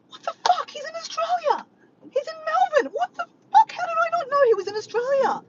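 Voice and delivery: high, loud shriek of disbelief; yelling in shock. Retold or imitated. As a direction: re-enact a frantic, high-pitched outburst. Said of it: "And I'm yelling out to Diego".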